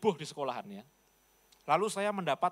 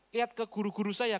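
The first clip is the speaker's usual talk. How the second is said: normal talking voice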